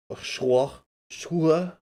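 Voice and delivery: schwa said the French way, as /ʃuə/; mock French accent. Shown as a demonstration. Streamer showing chat how Schwa sounds French, mock French accent. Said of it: "Schwa sounds a little French when you pronounce it a certain way".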